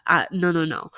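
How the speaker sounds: ordinary talk, nothing marked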